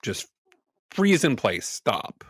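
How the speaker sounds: usual speaking voice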